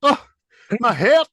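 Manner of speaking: high, loud mock swoon over Stifler; swooning fan. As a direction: squeal it like a smitten fan. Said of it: "everybody in the world went".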